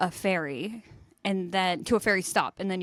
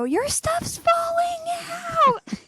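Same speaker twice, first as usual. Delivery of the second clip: very high, stretched-out little cry from the road; 3 dB louder, much slower than usual. Retold or imitated. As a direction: imitate a tiny voice calling out, squeaky high and slow. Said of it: "suddenly we hear a little voice".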